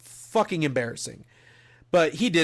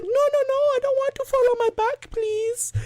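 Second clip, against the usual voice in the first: very high, whiny pleading voice; whimpering plea. Retold or imitated. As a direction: beg like a scared little kid. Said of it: "He was whimpering".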